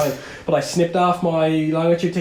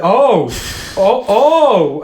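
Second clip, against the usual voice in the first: louder, higher mock panic, oh oh oh; panicked gasps; 5 semitones higher, 6 dB louder, much slower than usual. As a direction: speel de beller die schrikt. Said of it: "his voice got more and more panicked".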